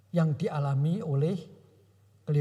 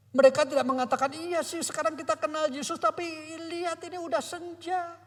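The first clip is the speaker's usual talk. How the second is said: impassioned preaching; 12 semitones higher than usual. Acted. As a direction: preach the disciples' grumbling excuse. Preacher voices disciples' excuse.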